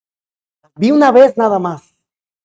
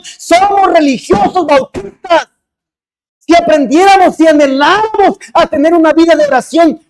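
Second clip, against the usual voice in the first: louder, higher, driving sermon delivery; full-force preaching; 8 semitones higher, 5 dB louder than usual. Acted. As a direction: preach it hard to the congregation.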